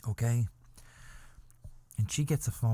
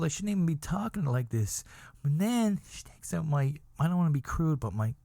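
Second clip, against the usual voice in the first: normal talking voice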